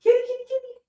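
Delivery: very high, tiny kitty call; cat-calling voice. Shown as a demonstration. Owner demonstrates calling the cat to fetch, cat-calling voice. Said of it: "And we're gonna say".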